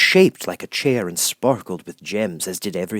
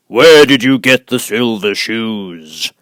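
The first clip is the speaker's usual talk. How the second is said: booming, much louder, very slow voice of the giant head; great and terrible Oz; 3 semitones higher, 12 dB louder, slower than usual. Acted. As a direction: loom over her, slow and thundering. Oz questions Dorothy.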